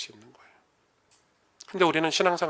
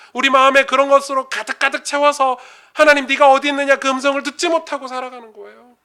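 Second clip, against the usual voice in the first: high, loud, building sermon climax; impassioned preaching; 9 semitones higher, 7 dB louder than usual. Acted. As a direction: drive it home with rising fervor.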